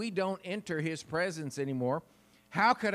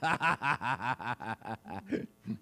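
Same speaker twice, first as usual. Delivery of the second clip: long, lower acted belly laugh; imitated laughing; 6 semitones lower, 4 dB quieter than usual. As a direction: let out a drawn-out ha-ha-ha. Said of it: "He started laughing some more".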